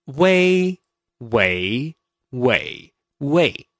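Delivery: "way" said four times, once in each Mandarin tone. Shown as a demonstration. Host teaching listener the four tones of "way". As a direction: sweep "way" through first, second, third and fourth tone. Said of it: "There are four different tones".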